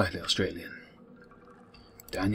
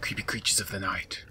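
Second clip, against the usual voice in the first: spooky whisper; 4 dB quieter than usual. Pretend. Spooky whisper about creatures.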